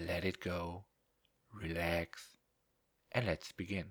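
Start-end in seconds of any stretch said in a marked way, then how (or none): none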